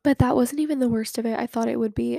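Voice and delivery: normal talking voice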